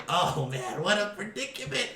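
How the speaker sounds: a hint of the goblin, rising into a squeak. Goblin fusses after landing, lightly high. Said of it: "he's like".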